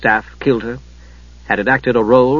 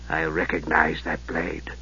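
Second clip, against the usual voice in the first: low, slow, soft and calm; quiet menace at half speed; 8 semitones lower, 11 dB quieter than usual. A narrator's reading. Raistlin eyeing Steele's father's sword, low and calm. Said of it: "Raistlin said calmly".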